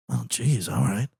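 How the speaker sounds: sulky attitude. Retold or imitated. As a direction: imitate him putting up with you. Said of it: "an attitude about it".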